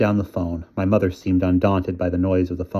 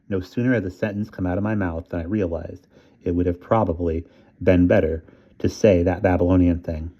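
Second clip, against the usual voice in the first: plain reading voice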